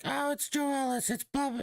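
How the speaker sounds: high falsetto mockery of fans. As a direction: mimic a fan with a silly high voice. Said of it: "some of you will be like".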